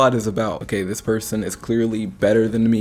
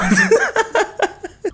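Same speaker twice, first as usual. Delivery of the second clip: high shrieking laugh. Real, not acted. Bursts out laughing.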